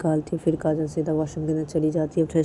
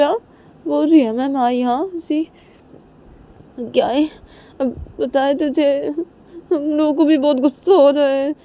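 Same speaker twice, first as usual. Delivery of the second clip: much higher, louder complaining rage; furious friend Nidhi; 9 semitones higher, 7 dB louder than usual. Acted. Nidhi tells Kajal about the night in the locked room, loud and furious. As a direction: rant and vow revenge.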